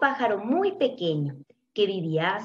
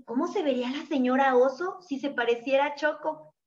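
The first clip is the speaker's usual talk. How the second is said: ordinary delivery, nothing marked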